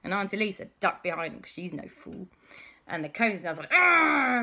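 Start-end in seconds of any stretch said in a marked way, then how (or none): 3.7-4.4 loud, high "aah"; dismayed wail. Acted. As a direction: wail like a beaten villain. Cone snails wail.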